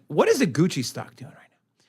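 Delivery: hushed conspiratorial aside; whisper. Real, not acted. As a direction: drop to a whisper. Whispered aside about Gucci.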